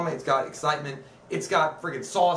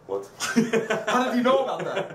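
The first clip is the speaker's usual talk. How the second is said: higher, hammed-up startled old man with a held pause; imitating an overacted dramatic beat; mock dramatic pause; 7 semitones higher than usual. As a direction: play the shocked pause for laughs. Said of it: "he even did, like, the, uh, pause".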